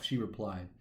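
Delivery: usual speaking voice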